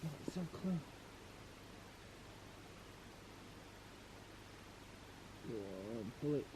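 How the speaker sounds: hushed whisper so fish aren't spooked. Real, not acted. Whispering while fishing at night.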